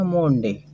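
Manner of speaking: "मोंडे" with a long 'oh' first vowel; misread Monday. Shown as a demonstration. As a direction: say Monday wrongly as "मोंडे", MOHN-day. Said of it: "ना कि हम पढ़ते हैं". ("मोंडे" में 'ओ' की मात्रा)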